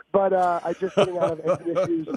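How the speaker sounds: ordinary talk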